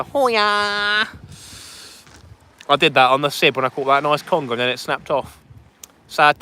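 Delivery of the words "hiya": very slow silly hiya. Pretend.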